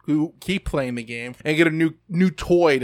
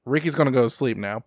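ordinary talk, nothing marked

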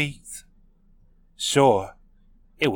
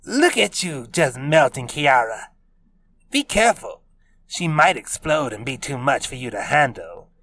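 higher teasing voice; 3 semitones higher than usual. A narrator's reading. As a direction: tease him playfully. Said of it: "in a sing-song voice".

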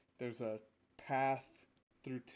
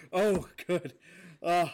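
laughing through the words; can't keep a straight face; stifled giggles; 12 semitones higher, 9 dB louder than usual. Real, not acted. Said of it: "I could not keep a straight face".

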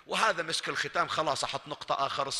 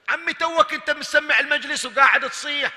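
surprised loud question; 11 semitones higher, 8 dB louder than usual. Acted. يسأل الزائر مستغربا.

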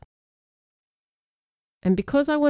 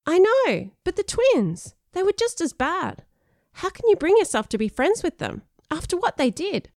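put-on high-pitched, exasperated boy; upset protest; 11 semitones higher than usual. Sean challenging a friend over bullies, indignant high protest.

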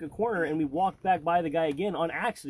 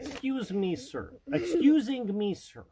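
put-on much higher, slow, broken-English Russian accent; Mishka Mishka voice; 7 semitones higher, slower than usual. Asking a store clerk for an apron, in a thick mock Russian accent. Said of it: "Mishka Mishka is a mixture of".

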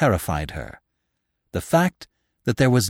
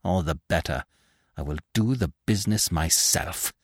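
low, soft, contained menace; half demented; 7 semitones lower, 6 dB quieter than usual. A narrator's reading. Widow decides to kill Lupin herself, low and half demented. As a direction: sink into quiet deadly resolve. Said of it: "half demented".

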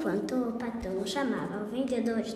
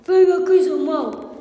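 police order; 3 semitones higher, 7 dB louder, slower than usual. Acted. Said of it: "A polícia chegou e falou".

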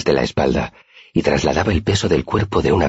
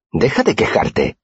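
higher, slow, mildly cross rebuke; curt retort; 7 semitones higher, slower than usual. A narrator's reading. Simón tells a complaining friend to stop, mildly curt. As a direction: tell a friend off, voice pitched up. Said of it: "me espetó Simón".

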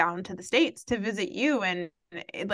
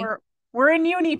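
raised, public-announcement voice; calling it out; 7 semitones higher, 5 dB louder, faster than usual. Real, not acted. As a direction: announce it louder and higher. Announcing it to listeners.

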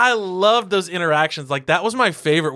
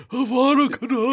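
mock spell; 8 semitones higher than usual. Joking Harry Potter spell.

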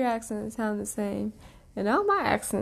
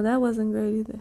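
ordinary delivery

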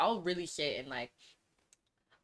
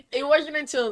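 laughing; 10 semitones higher, 10 dB louder than usual. Real, not acted. Still laughing.

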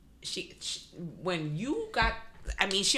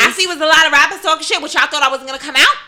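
higher, louder, fast taunting brag; 5 semitones higher, 10 dB louder than usual. Retold or imitated. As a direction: fire it off like a rapper clapping back. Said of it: "when she get hype".

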